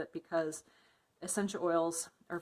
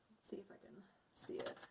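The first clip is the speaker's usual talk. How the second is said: whispered aside; 3 semitones higher, 16 dB quieter than usual. Real, not acted. Muttered aside while checking recipe.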